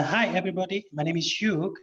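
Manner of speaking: normal talking voice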